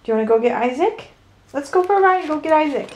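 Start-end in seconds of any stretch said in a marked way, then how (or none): 1.5-2.9 excited pet talk. Real, not acted. Inviting the dog on a ride.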